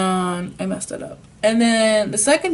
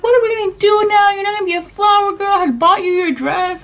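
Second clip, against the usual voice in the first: high, loud mother voice ranting; upset mom; 11 semitones higher, 4 dB louder than usual. Retold or imitated. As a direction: rant about the wedding and the dress. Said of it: "she was like".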